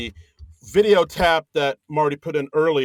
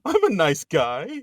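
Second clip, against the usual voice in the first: higher, slow wounded plea; mock offended protest; 4 semitones higher, slower than usual. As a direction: play hurt for laughs. Joking as offended [name].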